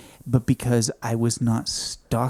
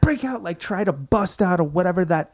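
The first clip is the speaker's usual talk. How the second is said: urgent high voice; 10 semitones higher, 4 dB louder than usual. Retold or imitated. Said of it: "I'm like".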